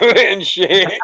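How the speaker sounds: breaking into laughter mid-sentence; laughing. Real, not acted. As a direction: start to crack up as you talk. Breaks up at own joke.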